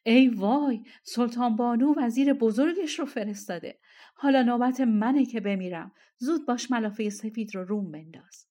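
loud, alarmed outcry, easing toward the end; frightened cry. A narrator's reading. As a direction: cry out in dread. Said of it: "از ترس فریاد زد". (فریاد از ترس)

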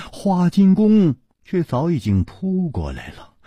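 plain reading voice

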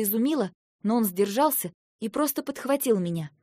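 usual speaking voice

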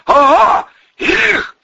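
high, loud yell back; shouted reply. A narrator's reading. Hostage-taker answers the sneering gunman, shouting.

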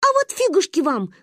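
put-on high-pitched defiant child; scoffing. A narrator's reading. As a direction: scoff at them like a cheeky kid. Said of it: "фыркнула Манька". (фыркая, задиристо и высоко)

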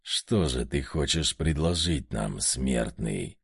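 низкое шипение одержимого; прошипи угрожающе и низко; шипящий демон. A narrator's reading. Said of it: "прошипел одержимый". (шипящий низкий голос демона)

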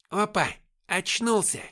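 high, loud sergeant's exclamation; громкое восклицание. A narrator's reading. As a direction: call out that the prisoner is awake. Сержант замечает, что пленный очнулся. (громкий возглас сержанта)

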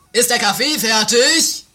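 high, loud slow call. Acted. Sergeant calls loudly to the maid in the kitchen. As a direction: call across the house.

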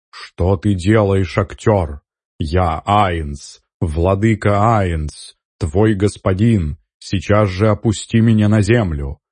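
ordinary delivery, nothing marked

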